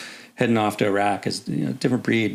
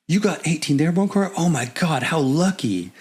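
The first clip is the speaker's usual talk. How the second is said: higher, gushing voice of a jealous buddy; envious friend; 7 semitones higher than usual. Retold or imitated. Friend calling in envy about the posting, high and gushing. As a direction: sound like you can't believe their luck. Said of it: "he was like".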